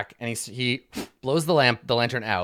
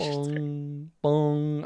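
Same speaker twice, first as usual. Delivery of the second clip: imitated bell, long ringing bong; tolling bell; 3 semitones higher than usual. Game master echoes the death spell, imitated tolling bell. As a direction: toll like a mournful bell. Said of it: "there's just the sound of dolorous spells".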